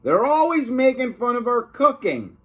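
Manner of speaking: a touch of an offended cook; indignant complaint. Acted. Miss Creant complaining about the boys, mildly indignant. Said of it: "cried Miss Creant".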